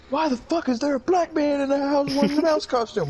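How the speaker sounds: very high-pitched, incredulous put-on voice; mock parental outrage. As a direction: squeal it as a hammed-up shocked parent. Acted parents' outraged question.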